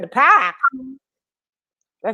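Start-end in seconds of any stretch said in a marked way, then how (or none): 0.0-0.5 Boston "The park?" said as "pahk", the r dropped; light Boston imitation, "park" with no r; Boston accent. Quoting Aaron from Boston, in a light Boston accent. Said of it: "He said".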